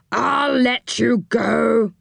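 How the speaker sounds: very slow, drawn-out surrender; grudging enchanter. A narrator's reading. Enchanter yields to Moonface's threat, grudgingly. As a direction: give in reluctantly, stretching the words.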